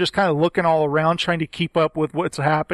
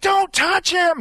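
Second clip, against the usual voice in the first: anguished cry; 12 semitones higher, slower than usual. Retold or imitated. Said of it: "she's like".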